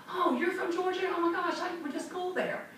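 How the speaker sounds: high-pitched, gushing surprise; delighted connection. Acted. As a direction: act thrilled at shared common ground. Acted rapport-building with a prospect.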